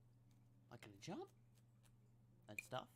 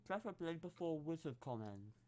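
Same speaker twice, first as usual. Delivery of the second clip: mouth full; 4 semitones higher, 5 dB louder than usual. Real, not acted. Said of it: "I'll just take my mouth full".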